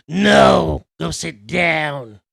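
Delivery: slow loud scolding teacher; stern teacher. Retold or imitated. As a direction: snap it like a strict old teacher. Teacher voiced in story.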